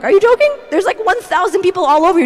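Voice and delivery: high, loud, fast disbelief; acted-out astonished protest; incredulous crowd. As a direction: blurt it out like a baffled crowd. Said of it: "And the people are like".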